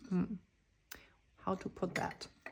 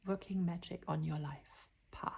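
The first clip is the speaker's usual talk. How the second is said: unvoiced hushed whisper; 8 dB quieter than usual. Real, not acted. Reader telling the viewer of the ancestors, whispering. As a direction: breathe it like a secret.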